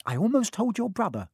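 high-pitched cross retort; snapped. A narrator's reading. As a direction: snap back indignantly. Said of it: "Hermione snapped".

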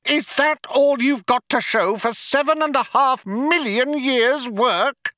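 very high-pitched indignant yelling; outraged yell. A narrator's reading. Loonquall berates computer over millions of years' work, outraged yell. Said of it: "yelled Loonquall".

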